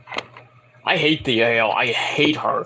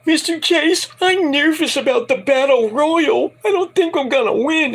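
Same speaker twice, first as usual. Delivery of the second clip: very high mock girly voice; nervous girl; 11 semitones higher than usual. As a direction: play a scared teen girl. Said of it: "Thea Hale says".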